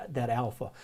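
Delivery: normal talking voice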